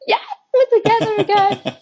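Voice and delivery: high-pitched mock squeal of delight; playfully overjoyed. Model reuniting with her photographer, giddy delight. Acting out joy at working together.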